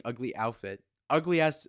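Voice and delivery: ordinary talk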